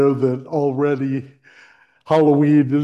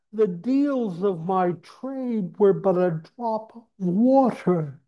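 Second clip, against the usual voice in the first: unearthly voice; 10 semitones higher than usual. Acted. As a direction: lament like a chained spirit. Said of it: "cried the ghost, wringing his hands".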